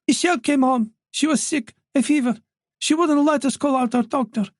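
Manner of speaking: put-on an elderly mother, voice lifted by worry; anxious old mother voice. Wife voiced by narrator.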